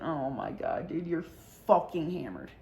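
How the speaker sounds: exasperated aside. Retold or imitated. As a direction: mutter it half-laughing. Muttering to drunk friend.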